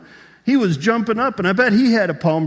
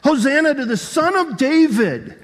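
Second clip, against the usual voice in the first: joyful crowd shout; 10 semitones higher, 6 dB louder than usual. Acted. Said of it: "they start shouting".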